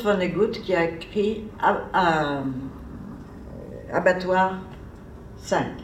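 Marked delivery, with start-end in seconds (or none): none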